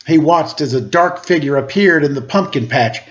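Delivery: plain reading voice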